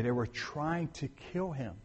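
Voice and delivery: usual speaking voice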